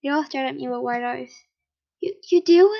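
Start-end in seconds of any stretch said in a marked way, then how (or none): 2.0-2.7 surprised. Acted. Shocked friend asking.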